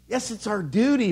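usual speaking voice

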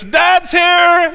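loud, high, cheery announcement; cartoon dad's entrance. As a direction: burst in like a proud dad calling out. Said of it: "and he says".

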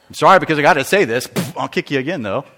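put-on low, quick, grudging child's sorry; insincere child's apology. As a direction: play a kid apologizing only because he must. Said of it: "just sorry because they got caught".

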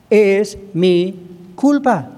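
extremely slow, "culpa" stretched and falling; slow confession. Shown as a demonstration. Pastor urging the church to confess, slow and solemn. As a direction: let "Es mi culpa" sink in syllable by syllable. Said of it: "aprender a confesar nuestros pecados".

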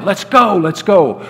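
mild narrated yell. A narrator's reading. Photographer hurrying the pilot to take off, mild yell. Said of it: "he yelled".

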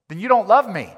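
loud wounded protest; indignant demand. Acted. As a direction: throw it back at him, offended. Acted reply in a quarrel.

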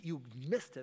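usual speaking voice

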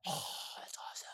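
whispered awe. Retold or imitated. Remembering inner reaction, whispered.

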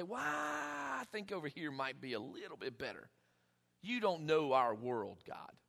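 mock hesitant, hushed pushback; reluctant objection. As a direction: hedge quietly as you talk back. Acting out his reluctant reply to God.

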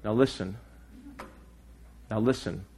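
a light imitation of a preacher, soft and low; Charles Stanley. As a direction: lightly mimic an old preacher saying listen. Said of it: "this is my Charles Stanley imitation".

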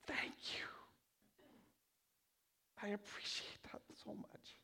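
breathy, hushed gratitude; whispered thanks. Acted. Thanking God for his gift, soft whisper. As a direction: whisper it as a tender secret.

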